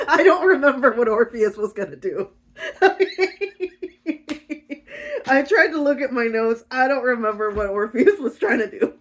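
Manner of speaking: loud, high, laughing confession; helpless laughter breaking into the words. Real, not acted. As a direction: crack up while admitting it. Laughs at forgetting the plot.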